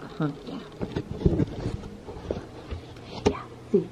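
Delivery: whisper, trying not to wake her. Real, not acted. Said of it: "the reason why I'm whispering".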